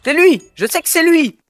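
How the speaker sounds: high, loud excited yells; accusing shout. Real, not acted. Gamer spotting the murderer, high accusing shout. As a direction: shout it out, sure you caught them.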